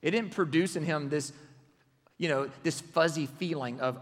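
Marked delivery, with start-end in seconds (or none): none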